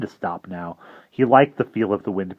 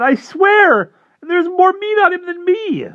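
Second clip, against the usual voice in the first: frantic pleading; 9 dB louder than usual. Acted. Boy pleads with vampire, high frantic whine. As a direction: bargain desperately for your life. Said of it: "a new round of supplications".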